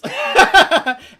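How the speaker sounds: a sudden high, loud burst of laughter. Real, not acted. Host laughing at curating her own experience, loud burst of laughter.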